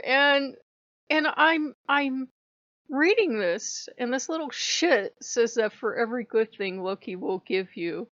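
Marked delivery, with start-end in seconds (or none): none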